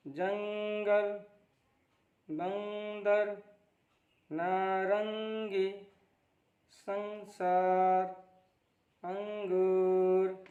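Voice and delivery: each word with its nasal hum held for a second, slowly; teacher stretches the nasal in अंग and हंस for pupils; nasal held demo. Shown as a demonstration. Teacher shows pupils the anusvar, nasal sound held long. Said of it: "नाक में आवाज़ ले जाकर एक second तक ठहरना है".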